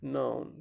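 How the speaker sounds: softly echoed नाउन with an 'ow' glide; correct pronunciation. Shown as a demonstration. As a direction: repeat नाउन after the dictionary, 'ow' not 'o'. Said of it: "ओ नहीं, ए की आवाज़ निकलती है".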